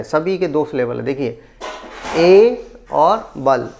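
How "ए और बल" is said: एबल split slowly into ए और बल; slow teaching breakdown, ए और बल as two separate beats; syllable by syllable. Shown as a demonstration. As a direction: draw out ए, then set बल apart. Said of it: "इसमें दो दो syllable है".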